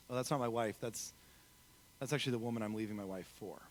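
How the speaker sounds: low, soft aside in a retold confession; quiet admission. Stranger admits his affair to the author, quiet admission. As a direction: let it drop low and soft, haltingly.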